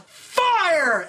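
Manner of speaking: high, drawn-out mock battle cry; yelled command. Head cannibal orders the tank to shoot, acted yell. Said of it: "The head cannibal yells".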